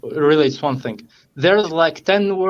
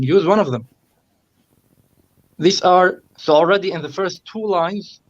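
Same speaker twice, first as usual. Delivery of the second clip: ordinary talk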